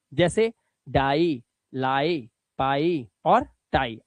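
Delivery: low, slow drawn-out examples; slow model words. Shown as a demonstration. Teacher modelling long-i words slowly. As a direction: draw each word out slowly. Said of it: "आई को आई ही पढ़ लो".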